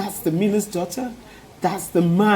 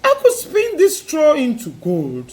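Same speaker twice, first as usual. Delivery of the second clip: little man voice; 7 semitones higher than usual. A narrator's reading. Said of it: "said the little man".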